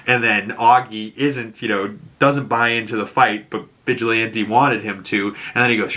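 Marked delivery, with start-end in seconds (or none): none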